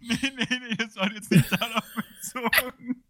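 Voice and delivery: laughing denial; giggling high protest. Real, not acted.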